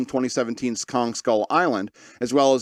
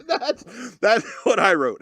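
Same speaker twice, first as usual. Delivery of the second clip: higher voice breaking into laughs; real laughter; 8 semitones higher, much slower than usual. Real, not acted. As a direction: get it out through your own laughter. Laughing at own joke.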